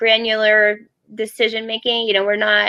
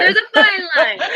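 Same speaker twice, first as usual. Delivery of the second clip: laughing; 10 semitones higher than usual. Real, not acted. Laughing while speaking.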